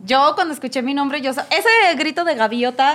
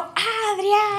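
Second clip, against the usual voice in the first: high, very drawn-out mock cry; long high call without full volume; own retold yell; 6 semitones higher, 4 dB quieter, much slower than usual. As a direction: imitate yourself echoing the cheer, stretched out. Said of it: "y yo".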